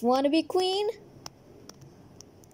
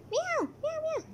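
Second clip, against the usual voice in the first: high soft kitty meows; cat meows; 4 dB quieter than usual. Acted. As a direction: meow like a little cat. Playing the cat.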